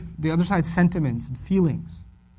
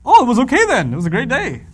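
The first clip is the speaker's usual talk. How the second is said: high loud oh then plain; mock naive reading; 5 semitones higher, 5 dB louder than usual. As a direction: play the clueless listener. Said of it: "You need to tell from that".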